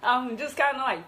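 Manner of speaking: real emotion creeping in, nervous laugh; getting emotional. Real, not acted. Guest opening up about finding their voice, getting emotional. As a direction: start to choke up mid-thought. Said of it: "this kinda emotional for me".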